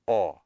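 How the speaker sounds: "A" said as an open aw. Shown as a demonstration. Teacher contrasting two vowels, open aw for "A". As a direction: open "A" into an aw sound. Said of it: "that difference of the sound".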